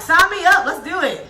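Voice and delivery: high, loud eager sign-up. Retold or imitated. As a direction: jump in with eager excitement. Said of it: "I'm like".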